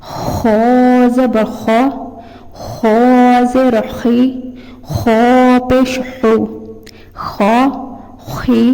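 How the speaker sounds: "खो" said full and heavy from the back of the tongue; "खो ज़बर खो" read as a heavy mufakham letter. Shown as a demonstration. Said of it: "इसे हम पुर करके भी साथ ही अदा करेंगे".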